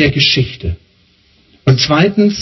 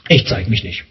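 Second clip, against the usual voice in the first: much lower, understated voice of God; God's quiet refusal; 11 semitones lower, 3 dB quieter than usual. Acted. God deciding to stay hidden from people, low and understated. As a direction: drop low for a brief, withdrawn refusal.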